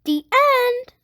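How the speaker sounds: lively picture-book read-aloud; reading to children. Acted. Host reading a Blippi book to young viewers, lively read-aloud. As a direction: read it out to little kids, bright and clear.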